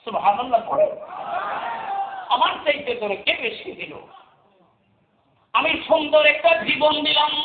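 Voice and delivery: high, ringing sermon chant; preaching at full force. Acted. Preacher voicing God's gifts to the listeners, in high preaching chant. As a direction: sing out each gift high.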